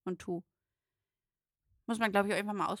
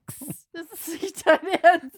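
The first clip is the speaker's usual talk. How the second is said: laughing outrage; 8 semitones higher, 10 dB louder than usual. Real, not acted.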